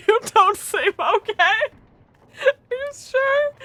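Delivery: high, slow, laugh-cry reading. Real, not acted. Player reading a line while helpless with laughter. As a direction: read it while crying with laughter.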